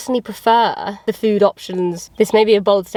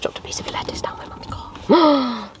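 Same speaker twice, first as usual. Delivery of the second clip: panicked whisper; 4 semitones higher, 4 dB quieter than usual. Real, not acted. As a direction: hiss it in alarm. Just dropped lettuce in car.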